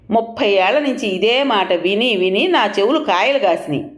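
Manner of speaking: exasperated. A narrator's reading. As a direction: complain as if worn down by years of nagging. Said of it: "అన్నాడు విసుగ్గా". (విసుగ్గా)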